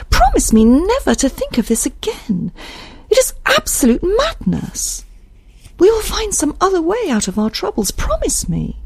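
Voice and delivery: high, urgent character voice; earnest high-pitched entreaty; pleading Isabel. Acted. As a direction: beg him for a promise.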